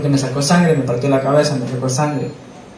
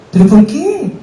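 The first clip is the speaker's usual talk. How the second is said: naive protest; 12 semitones higher than usual. Retold or imitated. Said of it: "decía así, mira, la bobera de uno".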